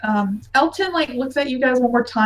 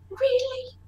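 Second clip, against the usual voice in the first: gasp, surprised; 10 semitones higher, 10 dB quieter than usual. Acted. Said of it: "Sinead looks like".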